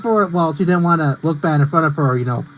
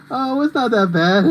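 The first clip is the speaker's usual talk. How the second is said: higher, slowed-down imitation; Pinkie Pie; 5 semitones higher, slower than usual. Retelling a YouTube poop scene, higher slow imitation. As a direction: say it high and drawn out, quoting. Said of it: "Pinkie Pie's like".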